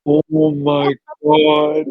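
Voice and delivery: slow, drawn-out re-enacted horror; gasp, then stretch the words in disbelief; shocked gasp. Retold shocked reaction to episode.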